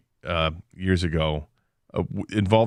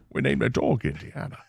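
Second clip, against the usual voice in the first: Sean Connery; 4 semitones higher, 4 dB quieter than usual. Pretend. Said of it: "ends up being Sean Connery".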